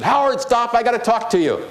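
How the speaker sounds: high, loud call to stop him; yelled at. Retold or imitated. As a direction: call out across the airport to halt him. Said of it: "this flight attendant yelled at me".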